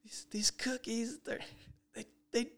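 high, half-whispered mock sob of distress; imitating crying fiancée. Fiancée upset about the wedding cookies, mock tearful. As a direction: play her teary panic over cookies, lightly mocking. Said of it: "started breaking down and crying".

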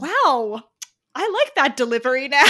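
loud, high impressed exclamation. Retold or imitated. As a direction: act suddenly impressed, loud. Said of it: "and I was like".

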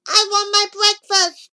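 high, loud squeaky toy-doll voice; talking baby doll. A narrator's reading. Squeezed doll demands breakfast, high talking-toy voice. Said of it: "if you squeezed its arm, it said".

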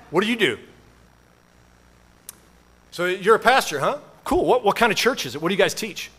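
a hint of a curious neighbor; inquisitive neighbor. Acted small talk with neighbor.

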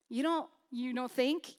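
ordinary talk

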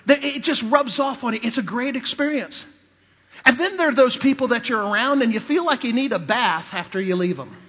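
ordinary talk

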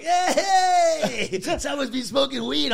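high, loud mock cheering; rowdy cheer. Comedians backstage greeting his red eyes, rowdy teasing cheer. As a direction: whoop it up like teasing mates.

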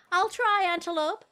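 squeaky, high and bright; high and eager. Acted. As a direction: promise it in a high voice. Shopper answers warmly moved.

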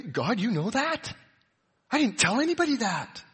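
high-pitched, incredulous question to God; mock surprise at being found out; startled disbelief. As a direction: act caught out, pitched well up. Acts out someone startled that God knows.

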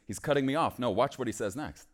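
normal talking voice

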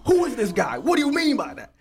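high, loud mock outrage; outraged followers. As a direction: play shocked online commenters. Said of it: "all the Caleb followers start canceling me".

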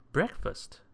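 high, lightly jolly presentation; cheery serving-up call; proud announcement. A narrator's reading. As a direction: announce it with a small flourish. Cousin proudly presents the meal.

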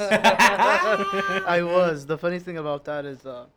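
talking around an ice cube; words through a mouthful of ice. Real, not acted. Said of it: "sorry, I have an ice in my mouth".

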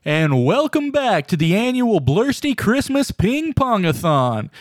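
sportscaster announcer. Acted. Third announcer take.

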